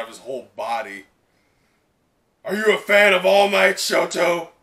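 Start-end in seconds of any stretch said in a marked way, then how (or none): 2.4-4.5 louder, higher character voice; playful anime hero; voiced character line. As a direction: read the subtitle in an eager hero voice.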